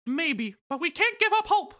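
Mickey Mouse voice. Acted. Voicing Mickey in recap.

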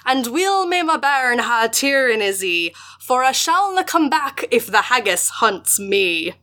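slow, drawn-out Scottish recitation; strange Scots voice. Acted. Host reads haggis poem to co-host in strange Scots voice. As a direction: declaim it slowly in a put-on Scots dialect. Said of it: "Just, like, a strange voice".

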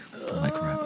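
breathy whispered title. Pretend. Cohost whispering the priest's future title to S0. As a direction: whisper the new title like a secret.

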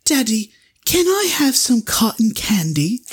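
put-on high-pitched little British kid; British accent. British kid asking Daddy for candy, British accent. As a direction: imitate a small British child pleading. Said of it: "That was my British accent".

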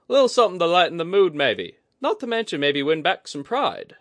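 high, loud, quick playful coaxing; Kudaka, teasing. A narrator's reading. Kudaka teases Oguri to win back pride, playful. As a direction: sweet-talk him into the race.

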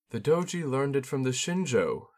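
a hint of a gracious court lady; Shizue's voice. A narrator's reading.

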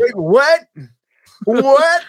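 high-pitched mock hard-of-hearing "what"; old, deaf Bond. Goofy old Bond who can't hear, hammed up. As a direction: squawk it like a confused old man.

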